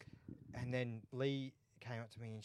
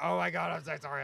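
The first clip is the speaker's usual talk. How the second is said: higher, louder mock panicked apology; flustered apology; 5 semitones higher, 6 dB louder than usual. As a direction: play her as embarrassed and rushing to apologise. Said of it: "and she's like".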